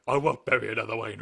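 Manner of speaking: lightly imitated film actor; Michael Caine. Podcaster as Alfred in the movie, light impression. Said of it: "Michael Caine comes in".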